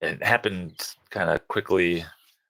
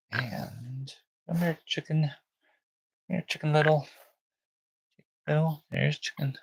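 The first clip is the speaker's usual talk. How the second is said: high, soft sing-song coaxing; 6 semitones higher, 3 dB quieter than usual. Real, not acted. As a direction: coo at the puppy like an infant. Calling newborn puppy.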